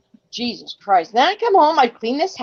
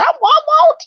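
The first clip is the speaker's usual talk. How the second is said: very high, squeaky and louder; mock promise; 6 dB louder than usual. Mother answering her son about jarred sauce, squeaky mock. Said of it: "I said".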